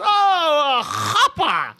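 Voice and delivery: the rental guy finally gets it, lifting high; the guy on the phone finally understanding, surprised; sudden realisation. Retold or imitated. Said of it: "And he goes".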